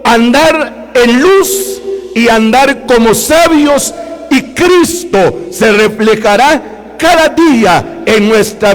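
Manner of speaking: slow, fervent closing exhortation; closing sermon blessing; fervent preaching. Acted. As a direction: stretch out the key phrases for the church. Preaching at full force.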